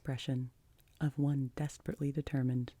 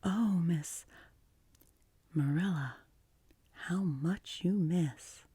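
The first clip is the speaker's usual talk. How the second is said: put-on slow, sighing child's lament; long-breathed sigh; 3 semitones higher, slower than usual. A narrator's reading. Anne pities her unimaginative guardian, with a long sigh. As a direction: draw the words out with pity. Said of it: "drew a long breath".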